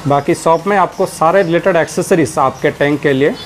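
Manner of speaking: ordinary delivery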